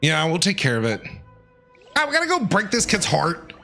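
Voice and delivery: usual speaking voice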